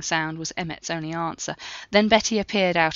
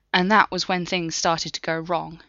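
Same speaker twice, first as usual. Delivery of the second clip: ordinary delivery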